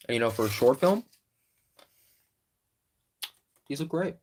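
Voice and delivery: chewing while talking; talking through a mouthful of food; mouth full. Real, not acted. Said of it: "Sorry if I'm chewing in your ear".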